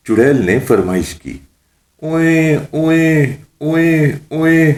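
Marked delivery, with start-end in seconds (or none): 2.0-4.7 put-on high, repeated child-like wails; mock baby wailing. Mullah crying like a child at the witch's request, mock wailing. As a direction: bawl like a baby on demand. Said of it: "ملا جی بچے کی طرح رونے لگے".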